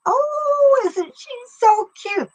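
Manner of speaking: high, gushing Minnesota grandma impression; Minnesota accent. The grandma cooing over a child, mimicked in a high Minnesota accent. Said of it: "the way she would do it".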